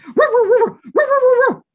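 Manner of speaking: high, loud yappy barks; barking dog. Acted. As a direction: bark like a little dog.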